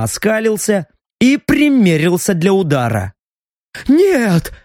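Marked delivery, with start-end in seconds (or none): 3.9-4.5 hasty gasp. A narrator's reading. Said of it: "торопливо выдохнул слуга".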